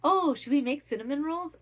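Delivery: light impression of a grandma offering to bake; a touch of a cheerful grandma voice; imitating grandma. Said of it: "she'd be like".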